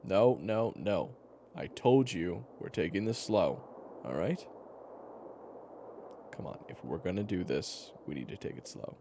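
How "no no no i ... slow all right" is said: loud, firm cutting-in; raised-voice insistence; insistent refusal. Acted. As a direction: put your foot down loudly. Acted firm refusal to rush.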